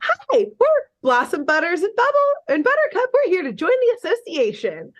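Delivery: high-pitched mock little-girl announcement; eager little girls. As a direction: pipe up high like kids introducing themselves. Voicing little girls bursting in.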